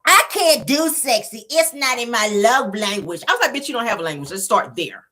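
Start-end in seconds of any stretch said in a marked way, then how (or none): 0.1-3.2 mock her excuse in a prissy voice; mocking Tisha. Vlogger mimicking Tisha's excuse, mock whiny. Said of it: "Tisha yells out".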